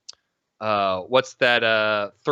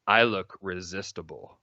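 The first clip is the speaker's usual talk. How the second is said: seductive; 5 semitones lower, 4 dB quieter than usual. Retold or imitated. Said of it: "seductively said".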